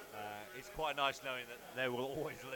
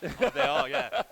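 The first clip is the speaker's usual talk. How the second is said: loud laughing, higher; 10 semitones higher, 10 dB louder, slower than usual. Real, not acted. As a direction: laughs out the words. Laughing at the accent.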